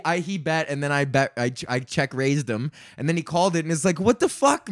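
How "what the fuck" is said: exasperated disbelief. Retold or imitated. Retold reaction to bad call.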